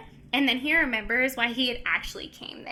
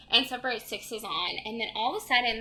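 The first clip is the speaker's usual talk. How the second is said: usual speaking voice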